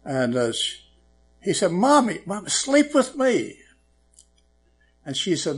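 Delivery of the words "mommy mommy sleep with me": put-on scared child. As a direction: imitate a child begging Mommy, pitch climbing. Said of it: "the child was scared".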